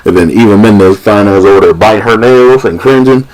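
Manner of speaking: ordinary talk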